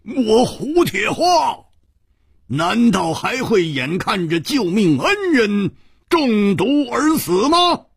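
high, ringing, proud proclamation; indignant, high-pitched heroic outburst; loud declaration. A narrator's reading. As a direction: declaim it, stretching the opening name. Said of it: "大声地说".

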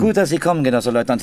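loud, pointed announcement to the lieutenant; loud greeting. Acted. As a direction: call it out across the room.

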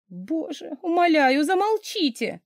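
high pleading moan; embarrassed nanny moans at her boss, high and pleading; moaning plea. A narrator's reading. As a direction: beg him to stop, moaning. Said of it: "простонала я".

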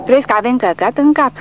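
indignant outburst; deeply indignant. A narrator's reading. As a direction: throw the question back in disbelief. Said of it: "întreabă Gregor profund indignat".

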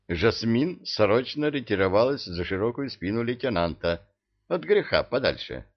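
ordinary delivery, nothing marked

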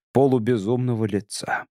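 plain reading voice

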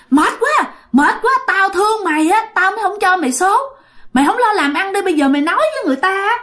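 high-pitched, fed-up telling-off; acted irritated rant; exasperated scolding. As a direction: scold him like a fed-up elder. Irate spirit scolds the begging man.

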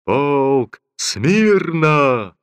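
slow, high, loud command from afar; parade command. Acted. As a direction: call the regiment to attention. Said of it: "донеслась издали команда Шульговича".

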